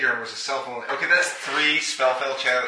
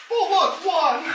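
much higher, delighted "oh look, one"; excited squeal; slower than usual. Real, not acted. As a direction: squeak it out in giddy disbelief. Rolled a one on dice.

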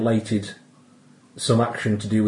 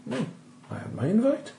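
put-on vaguely Welsh accent; 14 semitones higher than usual. Game master as Winthrop demanding to see the man, Welsh-tinged. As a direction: push a wandering Welsh lilt into it, insistent.

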